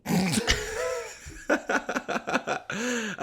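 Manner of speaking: long squealing laugh; high-pitched laugh. Real, not acted.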